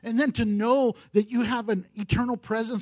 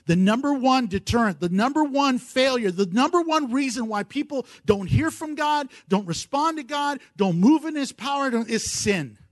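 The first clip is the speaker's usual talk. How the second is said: ordinary talk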